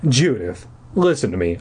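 usual speaking voice

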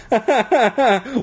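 bright burst of laughter. Real, not acted. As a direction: laugh at your own joke. Laughs at own joke.

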